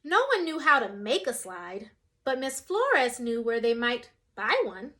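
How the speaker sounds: ordinary delivery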